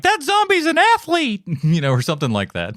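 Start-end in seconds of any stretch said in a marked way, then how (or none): 0.0-1.4 high-pitched slow mock yell; mock scream. Viewer yelling at a sprinting zombie, high and drawn out. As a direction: cry it out like a panicked moviegoer. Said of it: "screaming out like".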